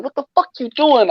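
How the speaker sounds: high, exasperated what are you doing; incredulous confrontation. Retold or imitated. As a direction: push back at him in disbelief. Confronting drunk cousin.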